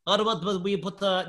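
mock a Russian challenger's accent; badly faked Russian accent. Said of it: "this accent is getting worse".

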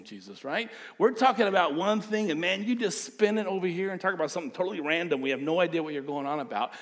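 exasperated, fast, rising complaint; a puzzled friend protesting, rushing it; confused disciples. Retold or imitated.

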